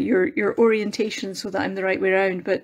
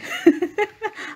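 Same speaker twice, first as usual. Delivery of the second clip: light laugh; 11 semitones higher than usual. Real, not acted. Laughs while saying goodbye to Christy.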